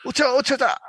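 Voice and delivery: high, indignant snap; mock angry outburst. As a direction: fire it off indignantly. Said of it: "すごい剣幕で怒るんですよ".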